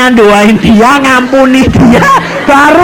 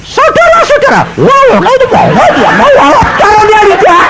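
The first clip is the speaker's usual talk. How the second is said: much higher, laughing wow wow babble; tongues in a speech; 13 semitones higher than usual. Retold or imitated. Danu leading the ceremony speech, babbling high and laughing. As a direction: sound like a speech taken over by tongues.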